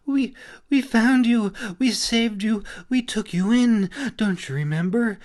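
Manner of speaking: fairy crying back at him, high and bitter; bitterly, through tears. A narrator's reading. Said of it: "she shot back at him bitterly".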